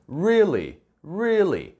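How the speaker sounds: "Really" lifted high at the start, then falling; emphatic REAL-ly; strong, lifted stress. Shown as a demonstration. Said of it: "좀 띄워서 강하게".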